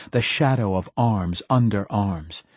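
usual speaking voice

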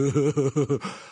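short amused giggle. Acted. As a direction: giggle lightly. Giggle after line.

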